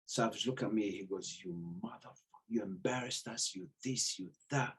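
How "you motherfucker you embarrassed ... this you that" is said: low, much softer reproach; hushed retold scolding. Gym owner to athlete who embarrassed the gym, hushed reproach. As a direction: keep the telling-off low and very quiet.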